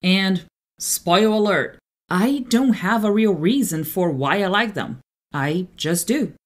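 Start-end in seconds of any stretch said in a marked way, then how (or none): none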